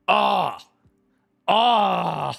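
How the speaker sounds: choked up. Real, not acted. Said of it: "that's gonna get me".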